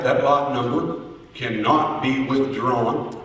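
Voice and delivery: ordinary delivery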